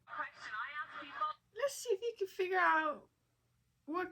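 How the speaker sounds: a high, shaky attempt at an Irish accent. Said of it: "I don't know how to do an Irish accent".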